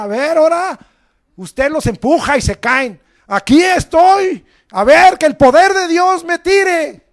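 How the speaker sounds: grita el reto, fuerte y alto; desafía a Dios a voz en cuello; desafío a gritos. Retold or imitated. (reto gritado con arrogancia)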